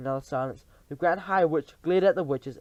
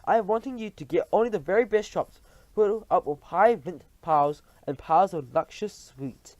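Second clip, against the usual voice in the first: high, loud commanding witch; Grand High Witch; 4 semitones higher, 6 dB louder than usual. Acted. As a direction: demand the very best.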